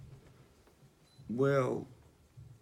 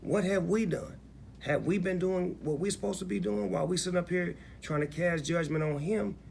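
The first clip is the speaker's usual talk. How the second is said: impassioned rhetorical questions; 7 semitones higher than usual. Retold or imitated. Artist urging people to stop judging [name], fired up. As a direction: challenge the listener, building up high. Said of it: "make you look at yourself and say".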